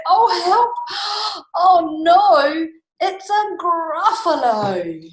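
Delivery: high, loud mock-panicked wail; hammed-up storybook terror, drawn out at the end; terrified cry. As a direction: cry for help with a gasp. Mouse terrified by the Gruffalo.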